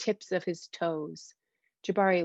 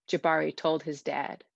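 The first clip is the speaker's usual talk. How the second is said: usual speaking voice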